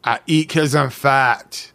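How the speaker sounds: slow, drawn-out movie-villain confession; Fat Bastard. Acted. Quoting a film character to a friend, slow character impression. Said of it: "to quote, uh, that great cinematic character, Fat Bastard".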